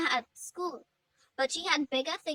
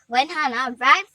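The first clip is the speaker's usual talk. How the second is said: ordinary delivery, nothing marked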